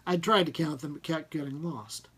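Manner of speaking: ordinary delivery, nothing marked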